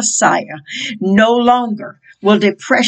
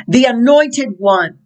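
usual speaking voice

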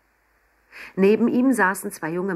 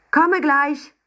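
much higher, loud call through the door; 10 semitones higher, 6 dB louder than usual. Acted. Emma calls through the door to Papa, loud and high. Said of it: "rief sie Papa zu".

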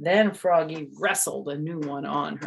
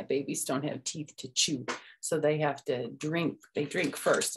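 ordinary delivery

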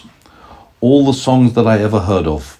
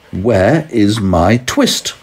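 stretched out slowly, pleading; slow plea; much slower than usual. Acted. Grandma reading, tailor asks the cat slowly. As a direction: draw the question out slowly.

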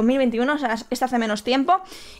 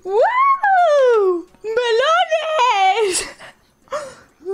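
high-pitched mock squeal; 11 semitones higher, much slower than usual. Streamer shrieking at a chat message, mock thrilled squeal. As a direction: squeal it like a giddy cartoon.

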